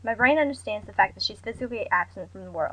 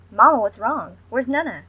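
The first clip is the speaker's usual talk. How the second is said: urgent call; 2 semitones higher, 7 dB louder than usual. A narrator's reading. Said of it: "trying to keep myself calm".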